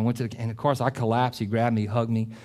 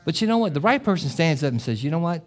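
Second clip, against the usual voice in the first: urgent preacher exhortation; 6 semitones higher, 3 dB louder than usual. Acted. Preacher urging couples to fight.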